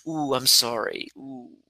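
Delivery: high, pitying "Ooh, I'm sorry" that fades out; pitying sympathy. Shown as a demonstration. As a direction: sound sorry for them from a distance. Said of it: "the energy and the physiology of sympathy".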